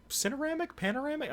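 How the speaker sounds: high questioning tries; high uncertain guesses; unsure guessing. Real, not acted. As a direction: fish for the right word. Groping for a word.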